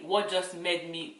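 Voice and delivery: normal talking voice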